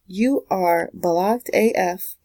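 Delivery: slow, heavy, each word spaced; slow blunt verdict. Shown as a demonstration. Telling the viewer they're blocked, slow and blunt. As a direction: drop the verdict slowly and bluntly. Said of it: "I'm saying that and laughing".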